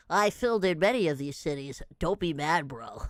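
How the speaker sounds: very low mock-deep voice; put-on deep voice. Streamer reading out a poster's reply, mock deep voice. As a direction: drop way down for the quoted poster.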